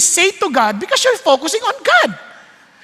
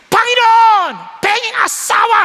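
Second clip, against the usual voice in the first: loud shouted prayer; 6 semitones higher than usual. Retold or imitated. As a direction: shout the prayer out, much higher and louder. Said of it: "Ang lakas mag-pray".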